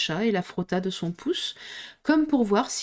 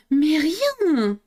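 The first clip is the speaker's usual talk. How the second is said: higher, louder impatient outcry; impatient cry; 7 semitones higher, 4 dB louder than usual. Acted. Alice crying out at the frog, impatient and louder. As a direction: cry out in exasperation. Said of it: "s'écria Alice impatientée".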